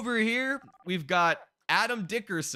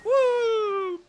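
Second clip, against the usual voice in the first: high, loud whoop on the final drop; excited woo; 6 dB louder than usual. Real, not acted. Player riding the slide, whooping at the drop. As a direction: whoop as the raft drops.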